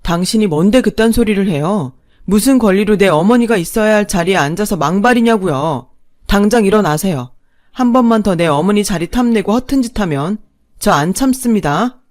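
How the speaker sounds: loud, firm confrontation; stern angry warning. Acted. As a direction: stand up for his mother. Husband confronts second mother. (크고 단호하게 화내는 남편)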